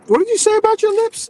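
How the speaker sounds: high, loud incredulous question; mock shock. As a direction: act shocked at what he heard. Shocked question about lips.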